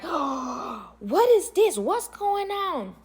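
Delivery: imitated shock, drawn-out high disbelief; gasp, then stretch the words out high; mock-aghast onlookers, slow and high-pitched. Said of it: "'Cause everybody's face was like".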